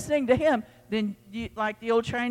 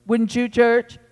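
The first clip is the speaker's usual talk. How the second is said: ordinary talk, nothing marked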